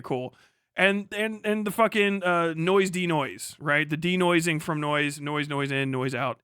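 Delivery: ordinary delivery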